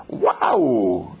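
high, drawn-out mock wow; playfully acted amazement; imitated thrilled child. Son thrilled at shopping offer.

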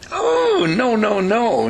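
very high "oh" sliding down through the no's; dramatic refusal. Acted. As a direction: wave it away with mock horror. Rejects the nagging advice dramatically.

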